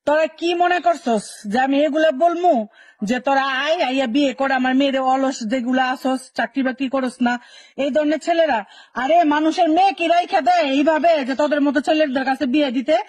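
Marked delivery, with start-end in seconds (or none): none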